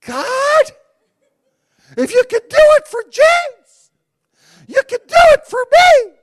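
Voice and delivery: high, loud, laughing plea to God; pleading prayer. Retold or imitated. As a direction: cry out to God, half laughing. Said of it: "I'm like".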